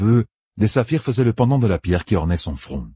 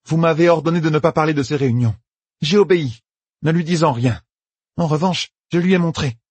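high, raised defiant explanation; 9 semitones higher than usual. Acted. Meidani defying the sitters, raised and defiant. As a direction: stand your ground against them. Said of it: "Egwene entendit comme du défi".

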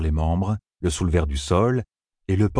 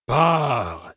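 drawn-out cry, pitched up; commanding cry; 5 semitones higher than usual. A narrator's reading. Oracle man ordering Matt out, commanding cry. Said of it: "cria l'homme".

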